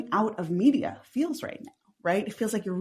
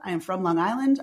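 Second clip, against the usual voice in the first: ordinary delivery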